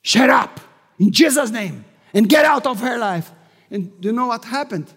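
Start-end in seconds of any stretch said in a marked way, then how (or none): 0.0-3.3 loud, stern command; commanding rebuke. Retold or imitated. Preacher rebuking the demon, loud commanding shout. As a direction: order the demon out firmly. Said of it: "I said".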